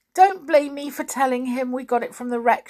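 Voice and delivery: put-on high, tearful little-girl plea; sobbing. A narrator's reading. Anne begging not to be blamed, sobbing. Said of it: "she sobbed".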